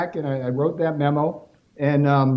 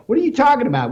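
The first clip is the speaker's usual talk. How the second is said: retold, raised and louder in disbelief; incredulous outburst; 8 semitones higher, 4 dB louder, much faster than usual. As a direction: bark the question like you can't believe it. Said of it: "And it's like".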